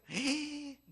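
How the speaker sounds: sharp shocked gasp; jadeo de asombro. Retold or imitated. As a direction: gasp in mock scandal. Gossip about a son, shocked. (jadeo escandalizado)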